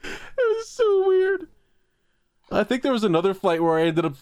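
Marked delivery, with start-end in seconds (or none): 0.0-1.5 high, laughing through the words. Real, not acted. Recalling the awkward seat next to the man, laughing.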